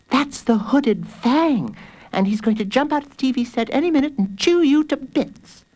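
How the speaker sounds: put-on high, menacing girl's voice; high-voiced sibling threat; scaring sister. A narrator's reading. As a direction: frighten the little brother, pitched high. Sister scares little brother.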